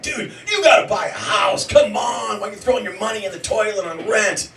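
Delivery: a hint of a preachy rich friend; insistent mock bro; pushy rich guy. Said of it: "And they're like".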